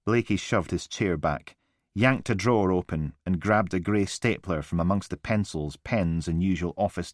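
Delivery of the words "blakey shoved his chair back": ordinary delivery, nothing marked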